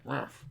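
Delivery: short wordless gruff grunt; imitated grumble. Poker player mimics an opponent reacting to every raise, grumbling. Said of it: "he would, like, grumble something".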